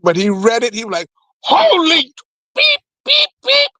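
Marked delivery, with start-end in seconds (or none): 1.4-3.7 very high, drawn-out yelp with squeaked beeps; hammed-up high-pitched shock; shocked yell. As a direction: cry out in alarm, censoring the swear with beeps. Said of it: "You don't yell out like that".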